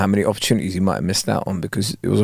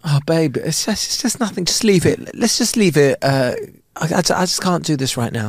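higher, softer, halting plea; evasive wife; 4 semitones higher, 4 dB quieter than usual. Acted. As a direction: brush it off softly, haltingly. Acted wife evading husband's confrontation.

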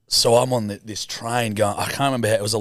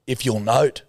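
usual speaking voice

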